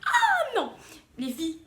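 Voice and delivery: high, loud laughing cry. Real, not acted. Recalling her new hairdo in the mirror, laughing. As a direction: cry it out through helpless laughter. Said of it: "Là, je rigole".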